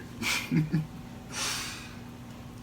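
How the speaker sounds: drawn-out breathy chuckle; amused laugh. Acted. Performer joking about the broken window, amused laugh. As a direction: chuckle breathily at your own story.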